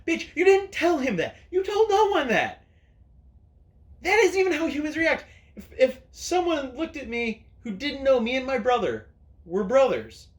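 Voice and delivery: real angry rant yelled at the camera; angry yelling. Real, not acted. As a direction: yell it at the screen, fed up. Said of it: "angry [name] yelling at a camera".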